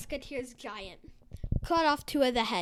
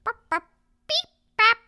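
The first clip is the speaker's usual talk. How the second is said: high, loud alien beeping; 9 semitones higher, 10 dB louder than usual. Acted. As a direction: squeak it out like an alien. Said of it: "the alien said".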